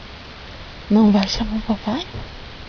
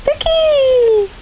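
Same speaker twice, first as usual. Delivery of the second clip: put-on high baby voice; 14 semitones higher than usual.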